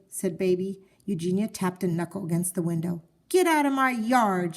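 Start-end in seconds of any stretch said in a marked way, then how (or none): 3.3-4.5 higher, louder order to leave; shouting. A narrator's reading. Eugenia through the window shouting at the pig to leave. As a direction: bark it at the pig in the yard. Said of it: "she shouted at Mercy".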